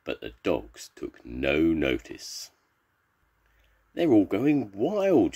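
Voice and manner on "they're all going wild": high, drawn-out cry of alarm; slow, pitched-up acted shout; storybook shout. A narrator's reading. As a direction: wail it out over the commotion. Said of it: "shouted the nurse".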